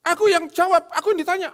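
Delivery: very high, loud mock indignant husband; hammed-up shrill protest; husband snapping back. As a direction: bristle like a husband who won't be corrected. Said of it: "suaminya bilang".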